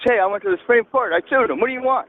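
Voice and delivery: high, mocking imitation of Obama; lightly voiced boastful politician. Caller mocks Obama talking to base.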